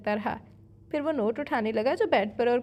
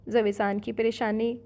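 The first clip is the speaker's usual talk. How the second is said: usual speaking voice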